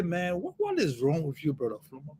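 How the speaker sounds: a weary God looking down and sighing at him; God's voice. Retold or imitated. God asking brother Flomo what is wrong, low and quiet. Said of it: "God is just sitting there and looking and say".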